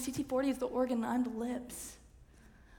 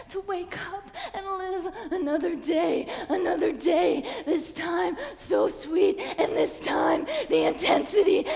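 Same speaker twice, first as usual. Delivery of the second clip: loud, higher, crying and laughing shout; screaming climax; 7 semitones higher, 17 dB louder than usual. Acted. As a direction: scream it all out.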